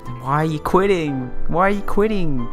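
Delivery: high-pitched, incredulous plea; pleading dismay. Real, not acted. Player begging Kagura to explain quitting, pleading dismay.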